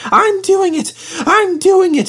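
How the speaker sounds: high, loud, breathless cry; panting engine. Acted. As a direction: pant it out mid-climb. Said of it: "he panted".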